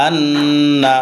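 আন্না with the doubled ন hummed through the nose; with ghunna. Shown as a demonstration. Teacher showing students আন্না with its double ন held as a nasal hum. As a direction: hold the ন্ন of আন্না as a nasal hum before the আ. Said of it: "ওয়াজিব গুন্না বলে".

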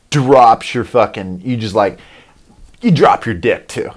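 usual speaking voice

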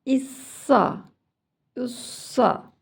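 "İssa, ussa" with heavy s and dark open "a"; thick s. Shown as a demonstration. Teacher taking a turn for children to copy, thick s. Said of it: "kalın bir sa".

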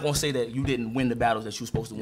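calm sincere. Real, not acted. Rapper calming his rival before the battle, low and sincere. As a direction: ease off and speak low and sincere.

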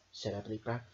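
ordinary delivery

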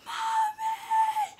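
very high, wailing squeal; re-enacted panicked cry. Overwhelmed girl calling for her mom, high panicked wail.

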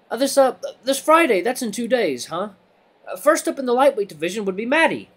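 high, halting timid voice on the mic; nervous rabbit announcer. Acted. Timid rabbit addressing the waiting crowd, nervous and high. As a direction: push through a public announcement nervously.

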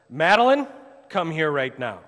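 put-on loud, sharp summons to a child; scolding dad calling his daughter over; stern parent call. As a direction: call her name up high and loud, then command. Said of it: "isn't gonna be very pleasant".

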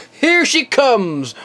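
normal talking voice

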